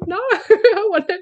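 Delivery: half-laughing panicked refusal; retold protest. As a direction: cut him off in mock alarm. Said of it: "I'm like".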